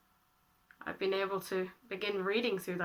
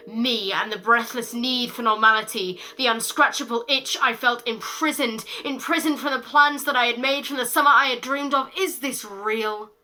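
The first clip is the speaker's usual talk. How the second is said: loud, higher, driving spoken word; breathless poetic climax; 4 semitones higher, 6 dB louder than usual. Acted. Poet longs for normality while locked down, urgent climactic recitation. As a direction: pile the phrases up without letting go. Poem climaxes on feeling imprisoned.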